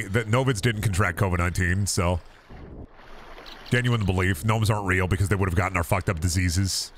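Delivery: normal talking voice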